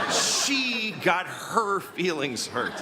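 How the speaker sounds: very slow, SHE and HER drawn right out; stress on SHE and HER. Shown as a demonstration. Comedian incredulous at friend dodging blame, SHE and HER dragged out slowly. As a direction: stretch "She got her feelings hurt" word by word. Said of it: "Such a weird way to phrase it".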